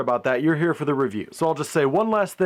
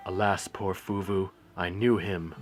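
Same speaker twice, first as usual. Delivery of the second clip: slow, quieter mock-Shakespearean recital; mock Hamlet; 6 semitones lower, 6 dB quieter than usual. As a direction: recite it like a ham Hamlet over the skull. Quoting Hamlet's skull scene.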